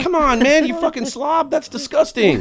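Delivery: high-pitched mock outrage; disgusted scolding. Man phoning the other girl, high-pitched retold disgust. Same angry phone call retold.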